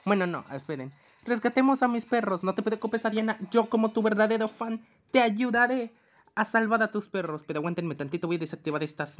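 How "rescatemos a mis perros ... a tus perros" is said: slightly hammed-up fan, pitched higher; devoted fan. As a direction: play the eager hero coming to help. Plays devoted fan rescuing dogs.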